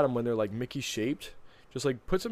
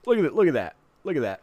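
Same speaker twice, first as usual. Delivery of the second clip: excited; 4 semitones higher, 7 dB louder, faster than usual. Real, not acted. Excited about dessert on screen.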